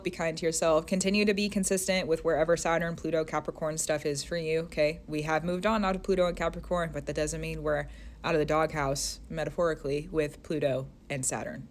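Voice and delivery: ordinary delivery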